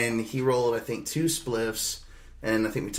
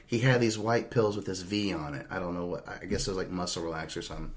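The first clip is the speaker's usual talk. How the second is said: normal talking voice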